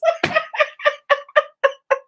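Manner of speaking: real delighted high laughter; high burst of laughter. Real, not acted. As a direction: crack up at the memory. Laughing at mama praising Jesus.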